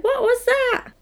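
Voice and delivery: very high-pitched mock alarm; mock startled woman. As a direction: squeak it like a startled film heroine. Mimics startled woman hearing a noise.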